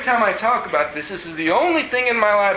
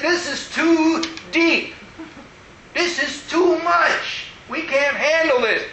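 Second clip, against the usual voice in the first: like someone who can't take any more; overwhelmed addict; 9 semitones higher, slower than usual. Retold or imitated. Addict quoted in class.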